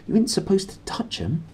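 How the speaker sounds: put-on warning boy. A narrator's reading. Roger warning Lyra.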